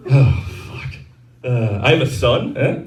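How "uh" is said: ordinary delivery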